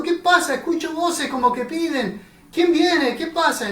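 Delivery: a blind man begging anyone to answer; anxious calling out. Acted. Blind beggar calls out to passersby.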